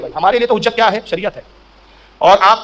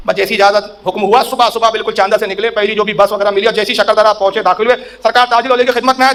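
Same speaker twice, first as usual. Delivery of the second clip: ordinary talk, nothing marked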